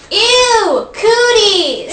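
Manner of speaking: high, loud playground "ew"; disgusted shout. Shown as a demonstration. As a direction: shout it like a grossed-out kid. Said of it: "I want you to shout".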